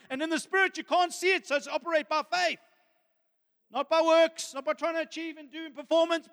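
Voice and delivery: high-pitched, urgent sermon delivery; impassioned preaching. Real, not acted.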